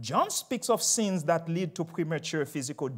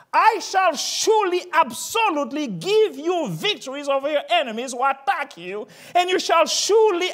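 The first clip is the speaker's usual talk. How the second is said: much higher, louder proclaiming; preaching at full force; 8 semitones higher, 6 dB louder than usual. Acted. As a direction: ring out each surely. Preaching God's promise.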